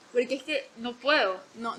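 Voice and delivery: ordinary talk, nothing marked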